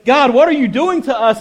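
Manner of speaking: mock the people grumbling at God; complaining Israelites. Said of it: "they would go".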